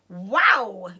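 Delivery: loud burst of amazement; young fox stunned by strong cider; gasped wow. Acted. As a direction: blurt it out after the gulp. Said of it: "he gasped".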